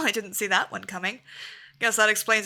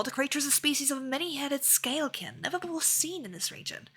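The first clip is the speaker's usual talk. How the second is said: hushed warning; 3 semitones higher, 7 dB quieter than usual. Acted. Ominous news of the beast.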